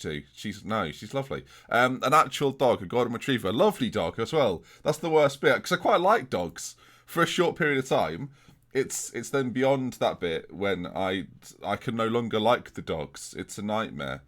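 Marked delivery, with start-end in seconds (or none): none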